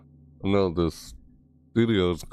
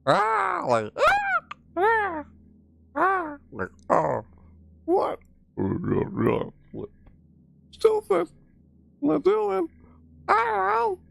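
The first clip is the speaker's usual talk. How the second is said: silly high-pitched squeals and squawks; cartoonish shrieky high nonsense voice; playing with voice; much slower than usual. Shown as a demonstration. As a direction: goof around way up at the top of your range. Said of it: "play around with my voice a lot".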